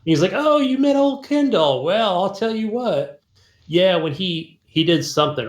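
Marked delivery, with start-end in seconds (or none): 0.3-3.2 higher-pitched retold character, drawing out the words; casino floor manager. Casino floor manager telling about old Kendall, put-on drawl. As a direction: put on a knowing bar-boss voice, higher and slower. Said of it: "the floor manager for the casino".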